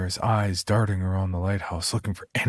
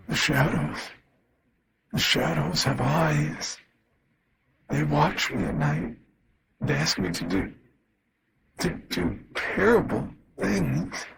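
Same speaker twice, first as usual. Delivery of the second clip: strained eerie voice; 6 semitones higher than usual. Acted. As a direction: let the words crawl out, strained and unnatural. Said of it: "My father's strained voice continued".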